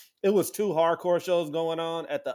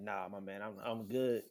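much softer, lower, laid-back no thanks; quiet refusal; 7 semitones lower, 12 dB quieter than usual. Retold or imitated. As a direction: wave it off under your breath. Declining the mosh pit.

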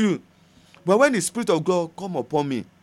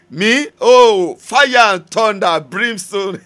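slow, booming proclamation; preacher roaring about his own temper; loud cry; 6 semitones higher, 9 dB louder, much slower than usual. Acted. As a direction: thunder it out like fire.

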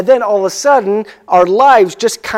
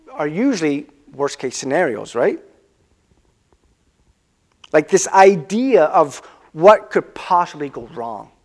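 ordinary delivery

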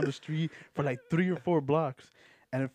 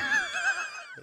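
loud laugh; 3 dB louder than usual. Real, not acted. Laughing at question.

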